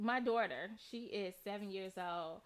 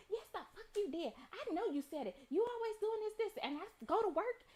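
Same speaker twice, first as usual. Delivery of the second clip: mock cursing mom; 8 semitones higher than usual. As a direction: rattle off the other mom's cussing. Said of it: "She was like".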